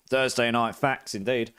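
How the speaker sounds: ordinary talk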